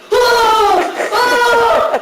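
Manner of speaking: high, loud shriek of alarm; shocked yell. Retold or imitated. Woman finding ashes in the urn, shocked yell. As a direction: recoil with a big whooping shout. Acts out shock on lifting lid.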